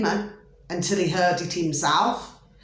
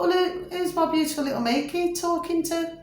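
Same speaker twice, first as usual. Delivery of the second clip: sing-song mum voice; 11 semitones higher than usual. Retold or imitated. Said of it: "And I thought".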